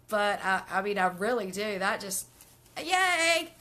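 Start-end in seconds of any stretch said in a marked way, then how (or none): 2.8-3.5 high, loud celebratory whoop; excited cheer. Real, not acted. Host cheers on a viewer, excited cheer.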